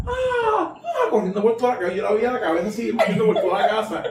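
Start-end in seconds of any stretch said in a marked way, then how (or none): 0.1-1.1 hammed-up shrieking; imitated screams. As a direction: shriek like a panicked woman. Retelling the woman screaming indoors.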